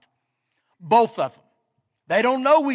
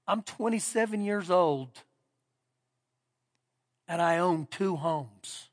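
tearful, softly; 5 semitones lower, 8 dB quieter than usual. Retold or imitated. Young husband telling the pastor he owns two homes, quietly moved. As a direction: say it hushed, still amazed and near tears. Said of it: "He has these tears coming down".

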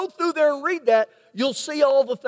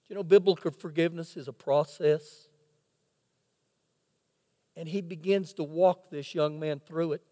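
quiet; 7 semitones lower, 6 dB quieter than usual. Real, not acted. Tender point in forgiveness story.

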